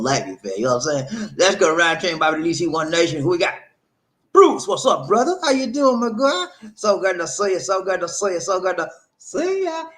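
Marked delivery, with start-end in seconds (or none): 1.4-3.5 raised, louder host holler; hype call-out. Real, not acted. Host calls out the next commenter. 4.3-9.8 very high, gushing hello; excited greeting. Real, not acted. Host excitedly greets viewer Bruce.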